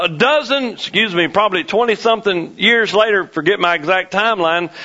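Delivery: normal talking voice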